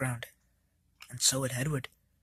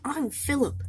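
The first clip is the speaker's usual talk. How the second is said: much higher, small bright engine voice; squeaky little engine; 11 semitones higher, 5 dB louder than usual. Acted. Philip introducing himself to the engines, in a high squeaky voice. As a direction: pipe it up like a tiny eager engine.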